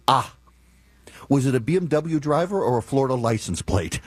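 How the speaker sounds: normal talking voice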